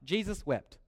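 usual speaking voice

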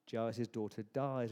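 ordinary talk

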